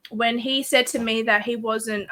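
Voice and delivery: ordinary delivery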